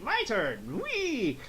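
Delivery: gleeful high mock squeal at the end; Goku. Goku taking his turn to transform, playful high glee. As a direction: swoop up into a playful cheer. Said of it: "Goku's like".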